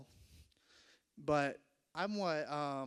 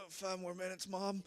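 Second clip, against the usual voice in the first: groggy pleading; 3 semitones higher than usual. Acted. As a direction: play a kid begging for more sleep. Woken from deep nap, pleading.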